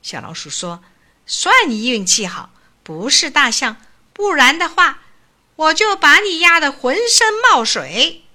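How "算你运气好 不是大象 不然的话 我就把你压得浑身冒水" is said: high, loud swaggering threat; bully the beetle like a tiny tough guy; mock threat. A narrator's reading. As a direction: mouse menacing the beetle, hammed-up. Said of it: "甲虫听到这番恐吓".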